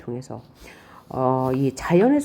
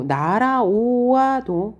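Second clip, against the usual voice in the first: 날아오아도 stretched slowly and pitched up; drawn-out syllables; 5 semitones higher, much slower than usual. Shown as a demonstration. Teacher showing rhyme-driven spelling, 날아오아도 drawn out. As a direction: linger on the vowels of 날아오아도. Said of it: "뭔가 운율을 맞추기 위해서".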